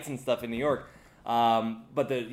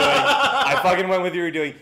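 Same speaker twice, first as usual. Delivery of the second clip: louder, higher, laughing through it; laughing protest; 5 semitones higher, 9 dB louder than usual. Real, not acted. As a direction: protest while cracking up.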